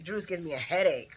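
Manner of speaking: ordinary talk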